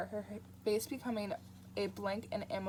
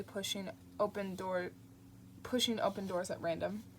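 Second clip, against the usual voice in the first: ordinary delivery, nothing marked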